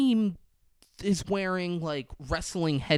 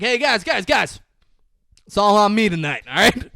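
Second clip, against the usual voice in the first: higher, louder eager call; rally the team cheerfully; lightly voiced excited [name]; excited treat; 6 semitones higher, 8 dB louder than usual.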